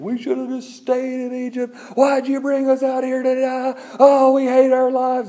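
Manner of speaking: hammed-up high-pitched moaning; mock whining. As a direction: whine like a griping crowd. Thirsty Israelites complaining to Moses.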